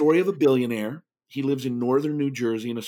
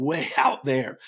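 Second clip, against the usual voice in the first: ordinary delivery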